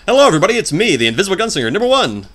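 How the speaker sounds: loud, high show-opening announcer voice; gunslinger voice. Shown as a demonstration. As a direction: belt out a big radio-host introduction. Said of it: "when I'm doing my gunslinger voice, I go".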